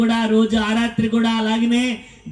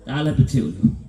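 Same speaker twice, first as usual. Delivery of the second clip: ordinary talk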